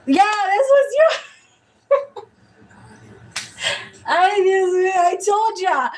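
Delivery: high, cracking giggle through the words; helpless laughter. Real, not acted. Reader laughing at mixing up two clients, high and giggling. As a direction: laugh it off, embarrassed. Laughing at her own mix-up.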